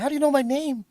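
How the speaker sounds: high, loud alarmed question; alarmed surprise. Retold or imitated. Reacting to fans knowing his name, high and loud alarm. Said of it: "And I'm like".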